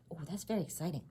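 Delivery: usual speaking voice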